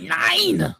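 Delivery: barked German "Nine", high and shouted; mock German shouting no; German accent. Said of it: "The German guy would be like".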